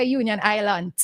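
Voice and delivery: "Island" ending in a hard T; imitated mispronunciation, "Island" closed with a T; T added to Island. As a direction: say "Island" as "eye-lant" in "Union Island". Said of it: "Where the T came out from?".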